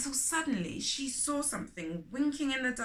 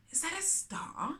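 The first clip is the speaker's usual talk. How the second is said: wondering; 8 semitones higher, 4 dB quieter than usual. A narrator's reading. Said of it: "she wondered".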